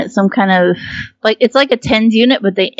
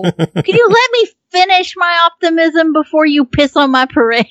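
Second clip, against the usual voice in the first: mock indignant; 8 semitones higher than usual. Real, not acted.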